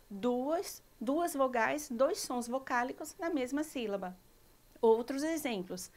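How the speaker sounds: usual speaking voice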